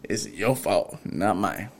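subdued muted comeback; retold quiet retort. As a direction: keep the blame to yourself, low. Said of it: "it was quiet".

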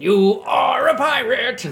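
high, slow, loud pirate bellow; pirate shout. Pretend. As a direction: belt it out like a cartoon pirate. Jokey pirate sign-off, drawn out.

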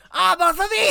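put-on very high, shouted old lady; shrill grandma voice. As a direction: screech it like a cackling granny. Voicing grandma in hell.

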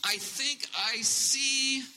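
high, slow, stretched-out words; hesitant lookout. Acted. Servant straining to see a cloud, slow and tentative. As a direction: draw it out as if peering far off.

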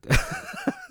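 high wheezing laugh; helpless squeaky laughter; real laughing fit. Real, not acted. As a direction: lose it completely, laughing till breathless. Cracks up at the letter again.

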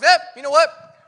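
loud, high, cheery yep; upbeat resolve. Retold or imitated. Paul rallying believers whose church fell apart, upbeat. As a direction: shrug it off and get going.

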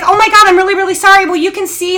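high, loud flustered sorry; flustered apology. Retold or imitated. Mother apologizing to the owner, high flustered. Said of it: "And I'm like".

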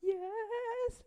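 high, strained mom voice; a high, pained mom caught in the middle; mock mom. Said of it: "she has to be like".

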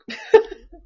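sudden high laugh; burst of laughter. Real, not acted. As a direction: laugh out loud. Laughing at her own setup.

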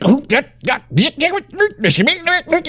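very high, frantic mock jabber; manic speedy yokel. Cohost mocking the yokel as on speed, manic high gibberish. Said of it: "a lot of speed in Alabama".

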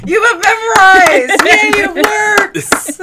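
high, loud delighted whoop; thrilled, high-pitched shouting; excited cheer. Real, not acted. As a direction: cheer it loudly, voice way up. Delighted cheer that the plug worked.

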